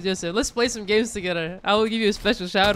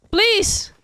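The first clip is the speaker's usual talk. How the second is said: high, pleading cry; urgent begging; 9 semitones higher than usual. Real, not acted. Gamer begs Joseph for the skin, high pleading. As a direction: beg with the pitch shooting up.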